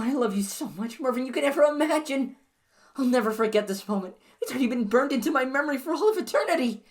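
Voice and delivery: put-on overjoyed girlfriend answering a marriage proposal; ecstatic. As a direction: squeal with delight at the ring.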